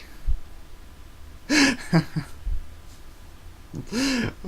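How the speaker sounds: long high wheezing laugh fit; cracking up silently and high; helpless squeaky laughter; high breathless giggle. Real, not acted.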